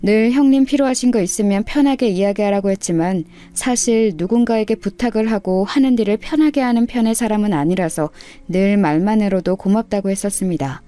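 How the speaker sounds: ordinary delivery, nothing marked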